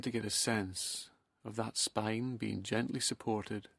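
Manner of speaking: soft, slow hypnotic meditation voice with long pauses; guided relaxation. Acted.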